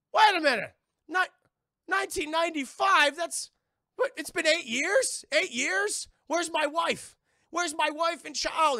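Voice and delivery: high, loud, alarmed questions; rising alarm at lost time; panicked realisation. Retold or imitated. Patient discovers eight years passed, loud panic.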